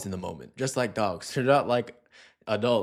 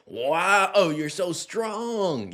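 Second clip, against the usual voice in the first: higher, slow teasing praise to a dog; mock praise; 6 semitones higher, much slower than usual. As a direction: tease the dog with fake awe. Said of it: "kind of joking about it".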